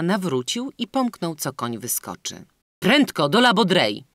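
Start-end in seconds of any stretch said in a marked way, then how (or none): 2.8-4.0 louder, higher call to the coachman; shouted order. A narrator's reading. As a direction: shout up to the driver to hurry. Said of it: "krzyknął Lousteau do woźnicy".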